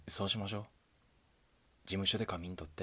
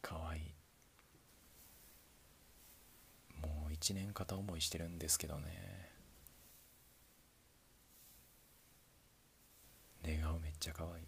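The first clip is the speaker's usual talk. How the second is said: put-on soft whispered confession to a sleeping girl; tender whisper; 8 dB quieter than usual. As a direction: murmur it so you don't wake her. Confessing while she sleeps. (寝顔に向けた優しいささやき)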